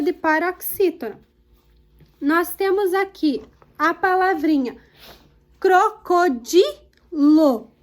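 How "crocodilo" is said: "crocodilo" very slowly, syllable by syllable, DI stressed. Shown as a demonstration. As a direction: break "crocodilo" into CRO-CO-DI-LO, leaning on DI. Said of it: "o di é a penúltima". ("crocodilo" sílaba por sílaba, com força no DI)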